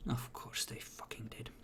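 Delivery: very soft, whispered bitter aside; whispered grumble. Acted. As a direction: grumble it under your breath. Angry character reacts under his breath.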